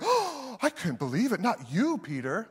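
high gasping mock shock; mock shocked pearl-clutcher. Pastor mocking a shocked Jesus, pearl-clutching. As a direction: clutch your pearls in fake disbelief. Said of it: "Jesus wasn't clutching his pearls going".

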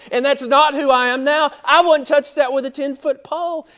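high, loud outraged church member; outraged believer protesting goat at church; indignant convert. Retold or imitated. Indignant Gentile believer voiced.

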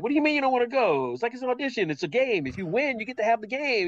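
high, quick-starting dad protest; incredulous pleading. Retold or imitated. Dad arguing with son who won't go to the audition, high-pitched incredulous protest. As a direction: push back in a high, disbelieving pitch.

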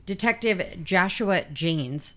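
slow, careful name reading; deliberately unhurried on the detective's name. Real, not acted. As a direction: take the name slowly, word by word. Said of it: "see, I slowed down for that one".

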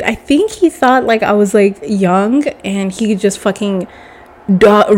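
ordinary talk, nothing marked

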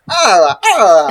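loud Italian-accented bird call; Italian corella squawk. Shown as a demonstration. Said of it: "So an Italian corella would be".

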